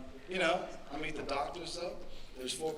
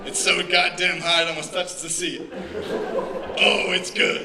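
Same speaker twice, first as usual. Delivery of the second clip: high, loud, laughing creepy lady; mock creepy lady; 4 semitones higher, 12 dB louder than usual. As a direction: ham up a proud, creepy lady showing off. Acting out a creepy older woman.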